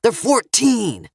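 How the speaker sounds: stressed THEY'RE, slowed; yelling. A narrator's reading. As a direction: shout the age up at the gunman. Father pleads for his kids.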